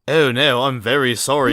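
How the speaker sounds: loud, high sarcastic apology; mock apology. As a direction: apologize loudly without meaning a word. Mocking apology to haughty rival.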